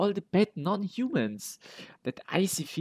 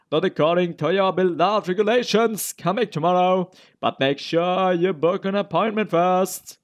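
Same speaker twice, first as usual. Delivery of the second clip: raised voice, higher and louder; loud brush-off; 4 semitones higher, 7 dB louder than usual. Acted. Doctor turning visitors away by his regulations, loud and dismissive. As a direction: shout the refusal through a door. Doctor argues back through the door.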